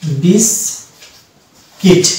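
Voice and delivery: বিস- কিট split in two parts; syllable drill. Shown as a demonstration. Teacher splitting biscuit into syllables for students. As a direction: pause between বিস and কিট. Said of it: "pronunciation হবে biscuit".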